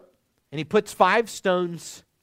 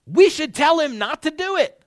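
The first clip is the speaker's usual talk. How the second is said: louder, higher, pressing the point hard; urgent advisor; 9 semitones higher, 5 dB louder than usual. Retold or imitated. Advisor urging his fellow to warn Saul, raised and pressing. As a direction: urge the others to step in. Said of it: "thinking something along the lines of".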